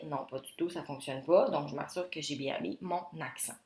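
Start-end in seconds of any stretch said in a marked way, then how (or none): none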